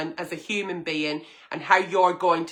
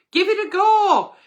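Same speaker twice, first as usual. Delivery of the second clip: higher and louder, upbeat; cheery encouragement; 13 semitones higher, 7 dB louder than usual. Real, not acted. Agony aunt cheering on the nervous viewer, excited. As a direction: cheer her on. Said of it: "cheery encouragement".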